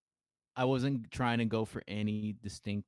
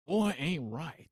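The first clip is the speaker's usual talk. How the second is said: higher Texan drawl, fond but disappointed; Southern dad voice shaking his head over his son; imitating a Texan cartoon dad who gives up on his boy; Hank Hill; 5 semitones higher, slower than usual. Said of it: "Hank Hill".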